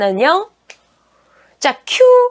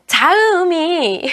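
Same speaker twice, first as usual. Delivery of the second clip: ordinary delivery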